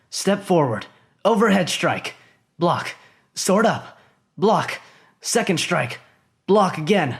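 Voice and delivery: high, slow, clipped-out move calls; tense play-by-play of a sword bout; inner fight commentary. Acted. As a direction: call each move as it happens.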